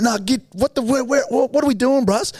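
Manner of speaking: scrambling, scared and confused; panicky questions. Retold or imitated. Lost in the slums, panicking at the driver.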